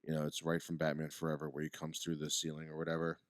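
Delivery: normal talking voice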